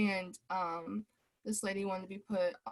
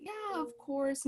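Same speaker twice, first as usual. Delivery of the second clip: higher, slow and kind; 8 semitones higher, slower than usual. Retold or imitated. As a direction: give a gentle, drawn-out yes. Server agrees gently.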